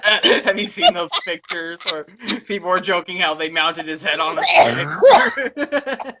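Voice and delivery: slow words through laughter; laughing. Real, not acted. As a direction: giggle through the question. Laughing about the pictures.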